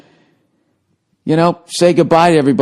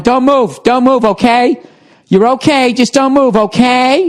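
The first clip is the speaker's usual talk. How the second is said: fussy lady; 8 semitones higher than usual. Retold or imitated. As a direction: imitate a lady fussing over the hurt man. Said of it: "she just kept going".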